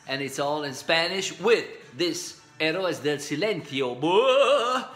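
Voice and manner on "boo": loud, high operatic "boo" sound; mock opera boom. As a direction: belt out a hammed-up opera cry. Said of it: "this theatralic opera style".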